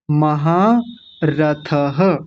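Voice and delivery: महारथः very slowly, ending in an echoed ha; voiced visarga. Shown as a demonstration. Teacher stresses the visarga, slow महारथः ending in ha. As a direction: stretch महारथः as ma-haa-ra-tha-ha. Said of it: "विसर्ग का जरूर ध्यान रखेंगे".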